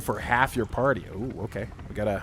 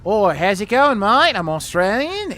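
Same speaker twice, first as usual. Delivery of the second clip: high, loud hammed-up Australian; exaggerated Aussie greeting, pitched way up; Australian accent; 9 semitones higher, 7 dB louder than usual. Streamer warming up for Mark, cringy Australian accent. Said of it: "do my best Australian accent".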